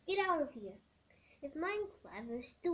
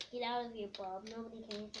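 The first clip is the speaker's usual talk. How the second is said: ordinary talk, nothing marked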